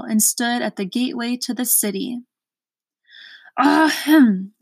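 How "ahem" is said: loud, higher throat-clearing; roared ahem. A narrator's reading. Gateman snapping to attention for visitors, roared throat-clearing. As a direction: announce yourself with a big ahem. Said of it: "roared the gateman".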